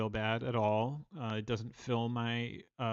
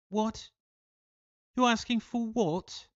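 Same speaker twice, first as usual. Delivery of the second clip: much higher, put-on English accent in disbelief; mock English accent; 10 semitones higher than usual. English locals puzzled by his request, in a mock English accent. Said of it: "they were like".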